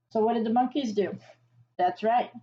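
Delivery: ordinary delivery, nothing marked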